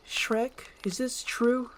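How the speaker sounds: high, wondering question; lifted voice, asking Shrek. Acted. Another figure voiced high.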